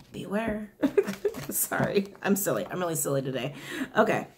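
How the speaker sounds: normal talking voice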